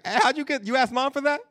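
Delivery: loud, high-pitched disbelieving question; incredulous questioning. Retold or imitated. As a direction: act out questioning a kid in disbelief. Questioning a child about getting cookies.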